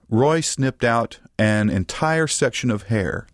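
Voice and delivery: ordinary delivery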